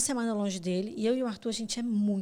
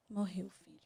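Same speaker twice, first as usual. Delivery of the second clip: much softer, gentle and grieving; soft sad confirmation; 8 dB quieter than usual. Retold or imitated. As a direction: break the news tenderly to her son. Mother tells son baby died. (mãe contando ao filho, baixinho e triste)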